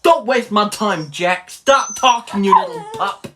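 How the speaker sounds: hammed-up roadman street talk. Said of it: "is a roadman".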